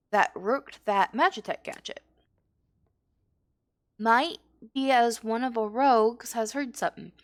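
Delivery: deliberate, in-character briefing; lightly voiced rogue mentor. Guild rogue briefing recruit on tracking thieves, lightly in character. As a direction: play a guild rogue giving orders, lightly. Reads game mentor's dialogue aloud.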